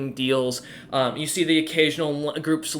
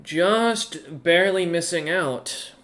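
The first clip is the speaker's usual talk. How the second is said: higher clerk voice; banker verdict; 4 semitones higher, slower than usual. Acted. Banker telling James his ranking, then calling him back. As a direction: deliver the ranking, then wave them in. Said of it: "She nods and goes".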